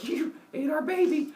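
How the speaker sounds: high-pitched wifely reproach; mock accusation. Rhea reproaching Kronos for eating the baby, high mock indignation. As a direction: push it high, hammed-up indignant.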